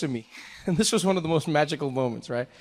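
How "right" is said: ordinary talk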